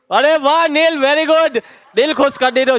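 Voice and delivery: high-pitched, excited praise; delighted praise. Real, not acted. Teacher cheering a pupil who got the answer, delighted.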